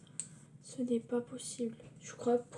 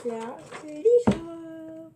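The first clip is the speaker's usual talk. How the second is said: excited; 6 semitones higher, 9 dB louder, much slower than usual. Real, not acted. Excited unicorn reveal.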